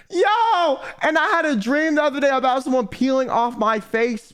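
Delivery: high-pitched, agitated outburst; high, frantic protest; worked-up alarm. Real, not acted. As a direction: burst out with a high "yo" and keep it up. Alarmed at link to the dream.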